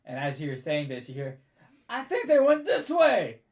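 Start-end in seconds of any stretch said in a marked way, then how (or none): 1.9-3.4 loud, high shout from off in the distance; shouted call. Acted. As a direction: call out to the others chasing. GM voices a shouting pursuer.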